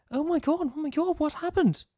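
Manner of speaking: put-on high girly voice. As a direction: squeal it brightly. Shannon character voice.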